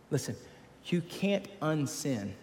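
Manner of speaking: normal talking voice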